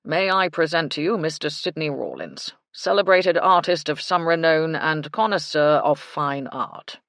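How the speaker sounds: lightly voiced pompous gentleman; a hint of stuffy self-importance, loud. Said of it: "in his pompous voice".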